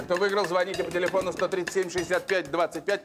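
ordinary talk, nothing marked

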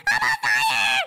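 high-pitched panicked yell; panicked scream. Pretend. Gamer on fire calls to sibling, panicked scream.

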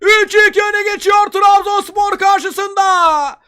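high, loud, slow excited shouting; screaming football commentator. Real, not acted. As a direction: keep shouting the comeback, drawn out. Excited commentary after the goal. (coşkuyla bağıran spiker)

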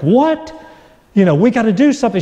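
high, fast panicked reply; wife in a rush of alarm, high and fast; wife panicking on the phone, high and fast; flipped out. Retold or imitated. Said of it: "like, flipped out".

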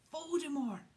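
loud, high shout, falling away; bellow. A narrator's reading. Harry defying Hagrid's ban on the name, bellowing. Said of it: "Harry bellowed".

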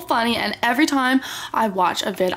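normal talking voice

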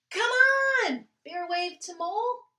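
put-on lively read-aloud for children; storytime reading. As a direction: read it like a picture book to kids. Reading picture book aloud.

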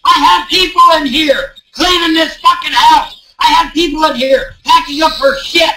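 real frustrated yell over the crowd; angry yelling. Real, not acted. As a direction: shout him down in real anger. Heated argument, calling across room.

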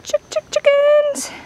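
ordinary talk

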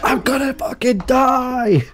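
high, drawn-out wail; mock dying panic. Choking man thinks he's dying, mock panic. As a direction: cry out slowly as if doomed. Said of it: "he actually thinks he's gonna die".

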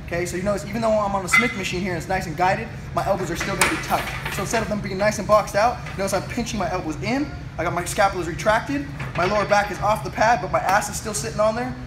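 ordinary talk

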